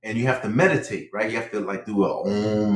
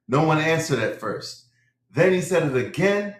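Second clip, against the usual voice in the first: usual speaking voice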